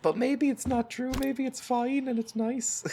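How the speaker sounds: high, singsong pretend reassurance; mock optimism. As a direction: pipe up in a sweetly doubtful high voice. Feigns a hopeful second opinion.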